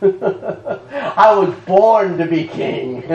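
slow, savouring laugh through the words; delighted slow repeat; chuckling and drawn out. Shown as a demonstration. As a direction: relish it slowly, laughing.

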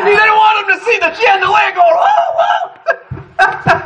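high, shouted laughter through words; laughing shout. Real, not acted. Preacher laughs out the chandelier story to the church, shouting. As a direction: lose it laughing.

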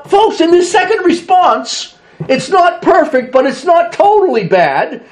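normal talking voice

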